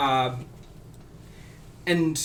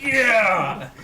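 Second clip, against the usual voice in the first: louder, higher rowdy whoop of a tipsy dwarf; drunken dwarf cheer; 6 semitones higher, 6 dB louder than usual. Acted. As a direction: raise the goblet and cheer. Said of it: "one of the dwarves, like, goblet in hand".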